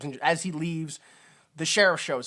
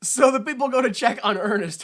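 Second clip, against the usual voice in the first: usual speaking voice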